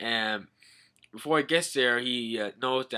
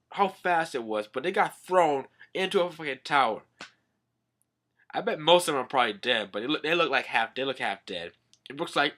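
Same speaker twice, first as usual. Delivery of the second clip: ordinary talk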